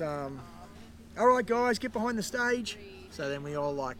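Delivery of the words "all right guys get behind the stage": higher and louder instruction to the group; principal giving orders. Retold or imitated. Principal telling the guests to go backstage, raised voice. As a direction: call it out like a teacher herding kids. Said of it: "Terry McKenna goes to us".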